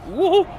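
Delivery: high, loud thrill whoop. Real, not acted. Sensations fortes en conduisant. (cri aigu d'excitation)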